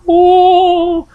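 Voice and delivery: a high startled oh; spooky oh. Acted. Reader hamming up the witches for listeners, high startled oh. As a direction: gasp out a high oh.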